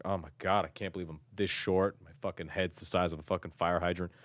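ordinary talk

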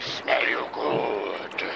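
deep slow troll growl. Acted. Troll sniffs out hiding Gary, deep slow growl. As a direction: rumble it like a beast sniffing.

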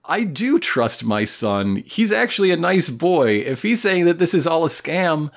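high-pitched, lightly mocking voice of a convinced mom; hypnotized, trusting mother. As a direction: play a mother talking herself into believing. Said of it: "hypnotic suggestion essentially be like".

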